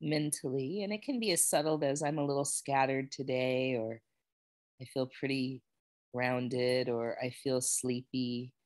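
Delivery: calm, unhurried guiding voice with long pauses; guided meditation. Real, not acted. Yoga teacher guiding students to notice how they feel, meditative. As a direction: ease them gently into noticing body and breath. Leads a settling body check-in.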